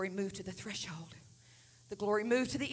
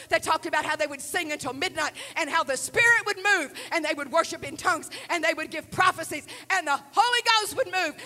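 preaching that builds louder and higher; fervent preaching; 7 semitones higher, 13 dB louder than usual. Real, not acted. Preacher telling church of [name]'s upbringing, building fervour. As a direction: drive it forward with rising fervour.

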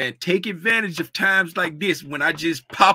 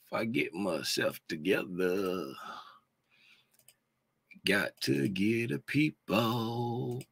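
ordinary talk

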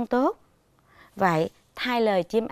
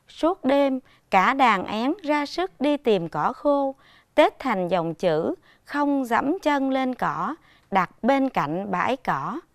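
slow, measured, clear breaks between phrases; phrased with pauses; 3 semitones higher, slower than usual. Shown as a demonstration. Teacher reading the long sentence, slow and carefully phrased. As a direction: pause cleanly at every comma. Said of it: "ngắt nghỉ hơi cho đúng".

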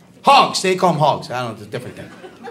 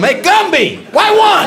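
high heckling yell; mock yelling; 11 semitones higher, slower than usual. Heckling the ride operator, a high mock yell. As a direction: call across the midway to taunt him. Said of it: "I start yelling at him".

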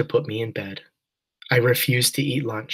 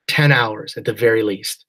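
ordinary delivery, nothing marked

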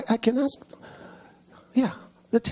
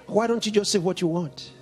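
ordinary talk, nothing marked

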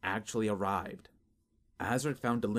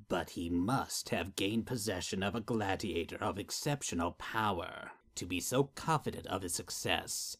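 menacing master voice. Acted. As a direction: speak down to a servant with menace. Master creature explains challenge.

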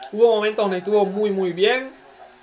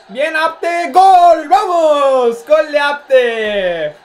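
screaming goal call; goal celebration shout; 7 semitones higher, 8 dB louder, much slower than usual. Real, not acted. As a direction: yell the goal as it goes in. Goal celebration while watching match.

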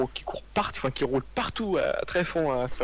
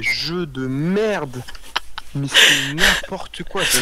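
« merde » traîné, lent et plus fort; exaspéré; 8 dB louder, much slower than usual. Real, not acted. Répète, plus appuyé. (exaspération appuyée)

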